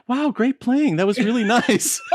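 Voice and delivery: high, sugary mock compliment through a laugh; mock-nice praise after a cutting solo; fake praise. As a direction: sweeten it falsely, laughing. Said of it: "he's gonna be like".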